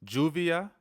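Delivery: "Lluvia" begun with a J sound; double L as J. Shown as a demonstration. Teacher giving an example of double L, pronounced as J. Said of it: "always gonna produce a J sound".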